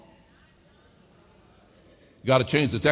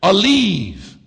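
louder and higher, shouted sermon climax; preaching at full force; 6 semitones higher, 5 dB louder, slower than usual. Acted. Preacher crying out the promises to the church, full-force whoop. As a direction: build the list into a shout.

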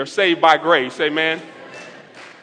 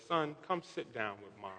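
very soft, low motherly invitation; quiet, tender quote; gentle retold mother; 7 semitones lower, 21 dB quieter than usual. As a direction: imitate Mom, hushed and low. Retells mother calling him over.

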